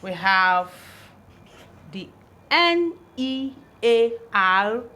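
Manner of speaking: ordinary talk, nothing marked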